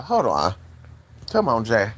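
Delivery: ordinary delivery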